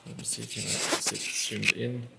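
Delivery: usual speaking voice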